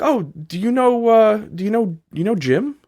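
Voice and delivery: put-on high, bright-eyed girl's question. Girl voiced in a story.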